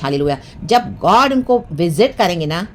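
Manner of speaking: normal talking voice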